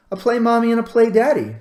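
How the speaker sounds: put-on a child repeating her demand, firm and high; insistent child.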